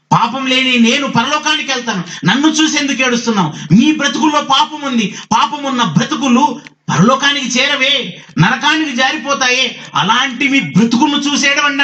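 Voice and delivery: high-pitched, driving rebuke; forceful preaching. Real, not acted. Preacher voices Christ rebuking the weeping women, forceful preaching.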